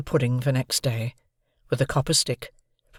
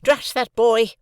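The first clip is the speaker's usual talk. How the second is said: high, louder scolding; cross sister; 9 semitones higher, 5 dB louder, slower than usual. A narrator's reading. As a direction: scold the boy sharply. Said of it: "frowning at me over her work".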